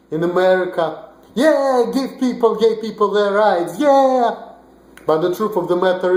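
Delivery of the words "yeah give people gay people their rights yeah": mock cheer. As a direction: ham up a cheering crowd to mock it. Mocking cheering American liberals.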